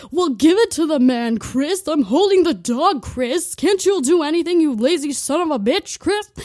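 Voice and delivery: loud, high put-on mom voice yelling; furious mom. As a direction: berate the lazy husband. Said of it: "my mom would be all like".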